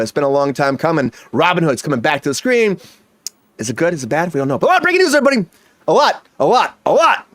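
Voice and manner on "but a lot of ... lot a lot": high, loud game-show host call; big hype announcer voice. Acted. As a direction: sell the breaking news like a TV announcer. Host announcing breaking news segment.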